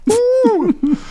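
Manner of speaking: sudden high-pitched woo; whooping at a friend's gross joke. Real, not acted. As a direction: let out a gleeful whoop.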